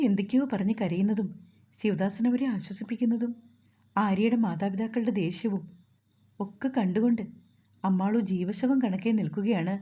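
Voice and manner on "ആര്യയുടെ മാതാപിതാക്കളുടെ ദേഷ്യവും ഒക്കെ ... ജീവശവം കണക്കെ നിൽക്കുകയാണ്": plain reading voice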